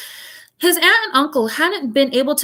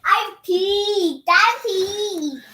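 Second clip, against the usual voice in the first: pet's voice; 10 semitones higher, much slower than usual. Acted. Voicing the pet.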